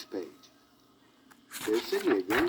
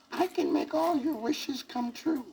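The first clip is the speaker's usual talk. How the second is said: put-on high squeaky old fairy voice. A narrator's reading. Fairy godmother squeaking down at the sad elephant. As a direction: squeak it out like a tiny old lady. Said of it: "a strange voice squeaked".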